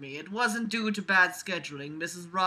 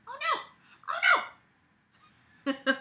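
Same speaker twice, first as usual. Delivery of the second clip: tiny, very high "oh no" squeal; squealed mock alarm. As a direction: squeal it in playful panic. Playful cries while pet bites.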